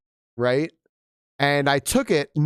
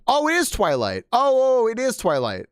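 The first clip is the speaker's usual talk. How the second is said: surprised realization; 11 semitones higher, 4 dB louder than usual. Real, not acted. Realizing what the book is.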